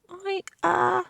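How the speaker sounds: put-on high, hesitant girl's stammer. A narrator's reading. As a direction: fumble for words. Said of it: "she stammered".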